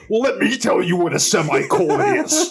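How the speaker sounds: high, exaggerated celebrity impression; Jesse Ventura. Co-host answers the baffled movie star, hammed-up Ventura impression. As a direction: answer as a hammed-up tough-guy explainer. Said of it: "you tell me how my Jesse Ventura is".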